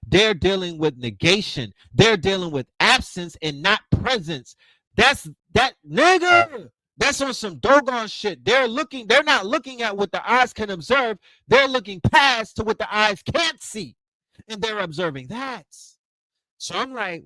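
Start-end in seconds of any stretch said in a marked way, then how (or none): 5.0-14.0 excited. Real, not acted. Excited by the cosmology.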